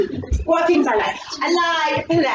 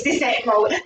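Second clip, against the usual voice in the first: ordinary delivery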